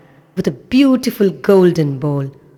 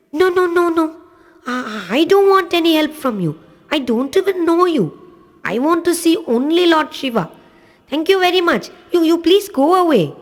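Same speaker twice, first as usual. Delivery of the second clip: put-on a small boy's voice, much higher, firm but polite; little boy refusing; 11 semitones higher than usual. Little boy refusing Lord Indra's help, high and insistent. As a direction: push the stranger away in a child's high voice.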